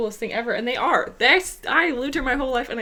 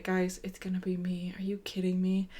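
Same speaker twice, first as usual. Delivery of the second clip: unvoiced whisper of doom; whispered dread; 4 semitones lower, 8 dB quieter than usual. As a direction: breathe it as a hopeless whisper. Telling companions it will be her.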